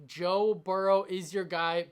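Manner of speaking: ordinary talk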